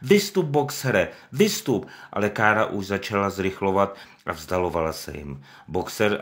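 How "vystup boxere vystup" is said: higher, louder, slow cry; shouting. Acted. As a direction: chant the plea together. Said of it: "začala křičet".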